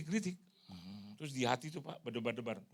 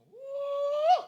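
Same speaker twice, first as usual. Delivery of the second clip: very high loud wail sound; mock sobbing gasp; 12 dB louder than usual. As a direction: wail out briefly. Imitated cry sound.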